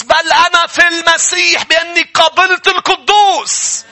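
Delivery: high, loud, slow preaching shout; a preacher shouting holiness at the church; full-force preaching. Real, not acted. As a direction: thunder it from the pulpit.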